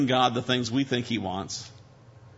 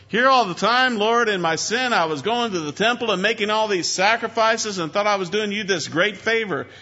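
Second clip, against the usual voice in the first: high, raised, acted appeal to God; acted prayer of dawning realization, pitched up; impassioned confession; 8 semitones higher, 4 dB louder than usual. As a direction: pour out the realization as David praying. Voices David's realization to God.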